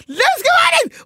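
high, loud thrilled calling of a name; loud delighted name shout; excited call. Retold or imitated. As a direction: call the name out, beside yourself with joy. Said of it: "Jag bara".

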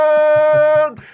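huge high roaring call of the name; jungle roar. Retold or imitated. Kid signals the others with a jungle roar, roaring. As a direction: bellow it like a jungle cry to summon the kids. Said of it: "djungelvrål som en signal".

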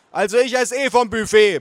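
high, loud holler; bellowing aunt. A narrator's reading. Aunt rushing off to the buffet, bellowing. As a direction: holler it to the whole table. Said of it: "brüllte meine Tante".

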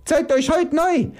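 very high strained exhortation; Roy's outcry. Acted. Roy urging the people to renew, crying out. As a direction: urge them on in a high cry. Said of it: "keucht Roy".

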